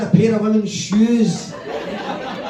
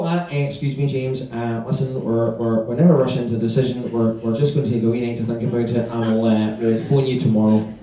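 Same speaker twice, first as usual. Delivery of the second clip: hesitant customer; 7 semitones lower than usual. Retold or imitated. Customer voiced making excuses.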